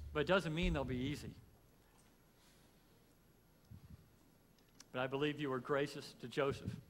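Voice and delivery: loud, earnest pastor's prayer that opens strong and settles; heartfelt pulpit prayer, loud at the start; closing prayer. Acted. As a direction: pray over the congregation as you close the service.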